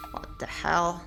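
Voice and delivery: normal talking voice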